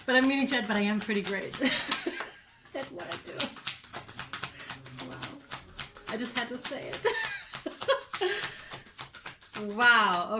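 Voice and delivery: giggling through a self-compliment; brag, then crack up at yourself; real laughter breaking through the words; laughing boast. Real, not acted. Laughing at her own boast.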